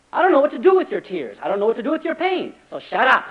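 mock honest helper losing patience; exasperated inner voice. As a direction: blurt out the discomfort. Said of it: "we don't say that, but".

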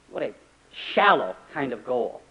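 normal talking voice

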